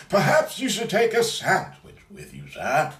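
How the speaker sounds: high, loud posh butler voice; Alfred impression. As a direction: play the old butler offering lunch.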